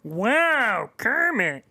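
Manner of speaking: high, loud bear puppet voice from the throat; Fozzie Bear impression. Podcaster aiming for Kermit but landing on Fozzie, high loud puppet voice. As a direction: hum it up in the throat like a silly bear. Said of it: "that was more Fozzie".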